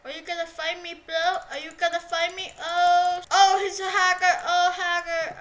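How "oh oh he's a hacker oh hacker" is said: loud, higher outcry of hacker; loud accusation. Real, not acted. Gamer accusing a rival of hacking, loud outcry. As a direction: cry foul.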